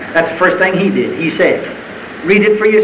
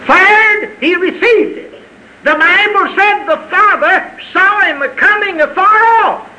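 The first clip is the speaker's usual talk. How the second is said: high, ringing pulpit proclamation; fervent, high-pitched storytelling; exultant preaching cry; 11 semitones higher, slower than usual. Real, not acted. Climax of the prodigal story.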